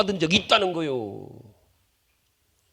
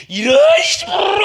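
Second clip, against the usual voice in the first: high, loud sputtering rage; fly into a fake fit of fury; mock angry outburst; 7 dB louder than usual. Preacher acting out an angry person's outburst, mock rage.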